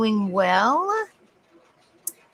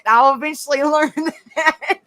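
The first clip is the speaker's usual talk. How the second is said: laughing; 5 semitones higher, 4 dB louder than usual. Real, not acted. Laughing at Aussie slang.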